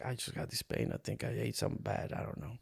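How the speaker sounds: barely voiced, hurting and downplaying it; hushed and pained, brushing off the worry; weak pained murmur. To his ex-wife while doubled over in pain, a weak hushed murmur.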